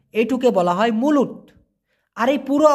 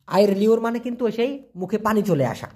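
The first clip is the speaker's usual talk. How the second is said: ordinary talk, nothing marked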